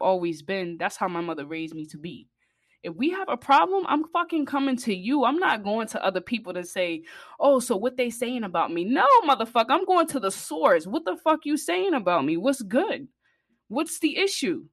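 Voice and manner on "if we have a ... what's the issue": worked-up rant, pitch climbing high; passionate rant. Real, not acted. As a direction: fire it up like you're calling someone out. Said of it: "Not to get all passionate while I'm speaking".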